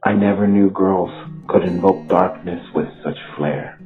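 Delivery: usual speaking voice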